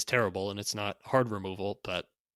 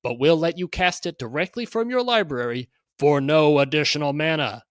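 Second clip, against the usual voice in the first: carnival barker; 2 semitones higher, 5 dB louder than usual. Acted. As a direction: hawk it like a sideshow barker. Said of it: "read this in sort of a carnival barker type voice".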